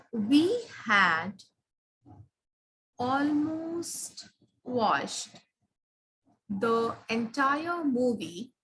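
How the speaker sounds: very slow, "almost watched" stretched out; slow example sentence. Shown as a demonstration. Teacher dictating a faulty sentence to the class, slowly. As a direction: read it out word by word, dragging "almost watched". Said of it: "if I say".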